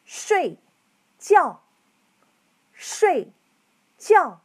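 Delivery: 睡 and 觉 each start high and drop sharply; 睡觉 twice, with steep falling tones; fourth tones. Shown as a demonstration. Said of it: "Let's try again".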